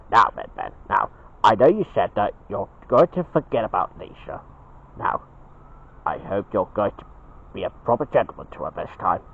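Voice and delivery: low, soft fatherly voice; Dad, gently admonishing. Acted. Dad asks Ben to behave with Betty, low and soft. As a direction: lay down a gentle warning, low and quiet.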